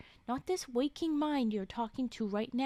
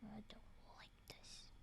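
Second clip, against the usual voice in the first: slow, much softer whisper; uneasy whisper; 3 semitones lower, 23 dB quieter, slower than usual. Real, not acted. Streamer uneasy at the scene, slow whisper. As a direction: murmur your unease to yourself.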